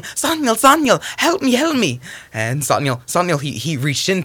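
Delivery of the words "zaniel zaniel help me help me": very high, urgent cry; yelling for help. Acted. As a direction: call for rescue at top pitch. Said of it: "she started yelling".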